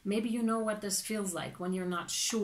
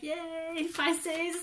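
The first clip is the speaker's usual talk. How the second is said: high squealed yay, breaking into laughter; giddy yay with laugh; 8 semitones higher than usual. Retold or imitated. Reader cheering the Pisces client, squealing and laughing. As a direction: squeal it with pure delight.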